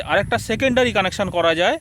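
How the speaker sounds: ordinary delivery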